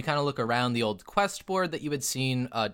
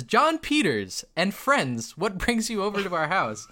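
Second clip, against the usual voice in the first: raised, higher hail to arriving friends; calling out; 6 semitones higher, 4 dB louder than usual. Acted. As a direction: call out a welcome from the stairs. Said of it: "he actually calls out to you".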